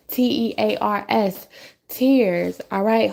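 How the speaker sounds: normal talking voice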